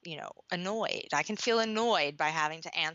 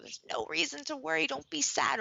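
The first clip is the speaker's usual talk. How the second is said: higher, bright reassuring parent voice, mock comforting; sing-song soothing parent; 7 semitones higher than usual. Parent brushing off a child's worry, higher sing-song.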